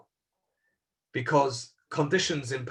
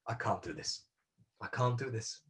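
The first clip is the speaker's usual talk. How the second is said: a broken near-whisper, much softer and lower; the boy giving up, barely audible; despairing hush; 4 semitones lower, 10 dB quieter than usual. Retold or imitated. Boy at the factory steps, crushed, a hushed despairing murmur.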